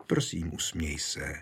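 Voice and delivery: low, soft, gentle plea; tender coaxing. A narrator's reading. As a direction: coax tenderly in a softened voice. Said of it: "přemlouvala ho něžně Konstancie".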